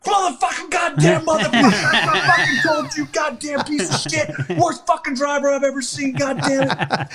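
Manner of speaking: very high, strained screaming tirade; imitated furious commander's rant; commander going off. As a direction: explode at the driver, voice shooting up. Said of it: "Starts going off".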